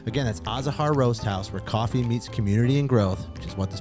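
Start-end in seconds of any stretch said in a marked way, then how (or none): none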